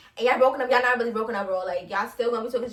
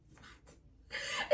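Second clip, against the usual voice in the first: laughing whisper; 18 dB quieter than usual. Real, not acted. Hushed repeat with laugh.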